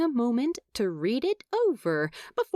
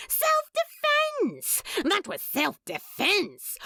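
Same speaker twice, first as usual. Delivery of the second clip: indignant protest; 8 semitones higher than usual. Acted. Crowley protests the murder charge.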